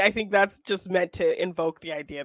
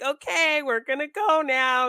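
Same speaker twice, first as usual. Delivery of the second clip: cute exit; 8 semitones higher, 4 dB louder, slower than usual. Retold or imitated. Mocking a cute club exit.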